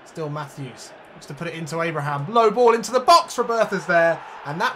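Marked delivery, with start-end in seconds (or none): 2.3-4.8 much higher and louder burst; excited goal call. Real, not acted. Calling the fourth goal, excited shout. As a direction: shout as the cross comes in. Commentating a goal being scored.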